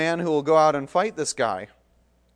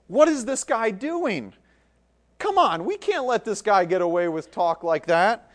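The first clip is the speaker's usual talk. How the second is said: higher, scoffing jeer; mocking crowd; 7 semitones higher, 4 dB louder than usual. The people mock David's boasting, jeering. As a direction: scoff at the upstart. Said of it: "angered at him and mocking him".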